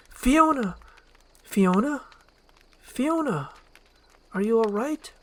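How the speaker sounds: high, loud calling out; worried call. Acted. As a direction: call to her anxiously.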